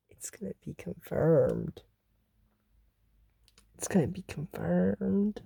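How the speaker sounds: hushed prediction. Real, not acted. Low ominous repeated prediction.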